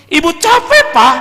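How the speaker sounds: high, loud whiny complaint; mock wife wailing she's exhausted; whining wife. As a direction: whine back at him like a tired wife. Wife answering back husband.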